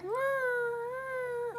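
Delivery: high mewing meow imitation; cat meow. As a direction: mew like a little cat. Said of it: "I heard".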